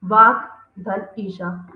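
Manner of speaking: वाक धन ईशा said slow and apart; split slowly. Shown as a demonstration. Teacher splitting वागीशा into parts, slow and deliberate. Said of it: "संधि विच्छेद किया".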